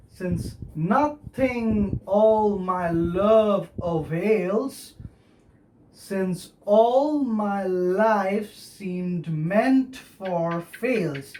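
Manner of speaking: very slow, drawn-out verse recitation; measured metrical reading, beat by beat; scanned verse. Shown as a demonstration. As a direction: stretch each stressed syllable of the line. Teacher recites verse to show metre.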